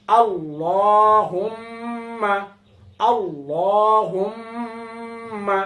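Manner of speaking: আল্লাহুম্মা stretched very slowly, doubled ম held, falling at the end; careful pronunciation. Shown as a demonstration. Teacher modelling আল্লাহুম্মা for students, slow and drawn out. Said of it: "আয়না দেখে দেখে পড়ুন".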